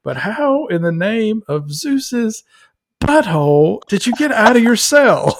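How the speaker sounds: hammed-up Cage outburst; imitating Nicolas Cage demanding how he escaped; incredulous Nicolas Cage. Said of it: "he's going through all the cool things".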